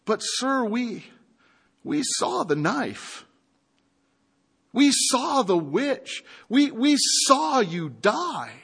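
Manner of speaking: high, slow, bewildered pleading; confused. A narrator's reading. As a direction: falter through it, unable to believe. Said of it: "rather confused by all of this".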